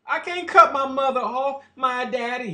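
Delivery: put-on complaining voice; mocking excuse-maker. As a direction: mimic someone pleading that they can't. Mocking people who make excuses.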